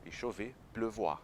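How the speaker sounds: ordinary talk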